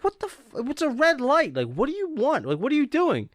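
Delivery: high-pitched mock outrage; exasperated, pitch way up; indignant protest. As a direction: imitate a pedestrian protesting at a driver. Pedestrian argues with honking driver.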